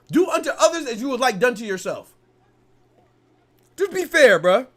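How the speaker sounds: loud, high, worked-up rant; heated rant. Real, not acted. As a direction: hammer home the demand for fairness. Heated rant about fairness.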